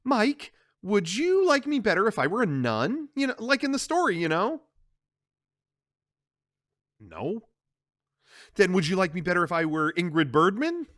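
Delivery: lightly voiced flirty Kay; Kay teasing.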